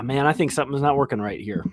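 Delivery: ordinary talk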